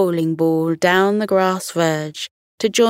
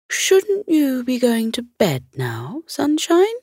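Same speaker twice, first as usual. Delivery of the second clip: moon voice; 7 semitones higher than usual. Acted. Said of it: "asked the moon".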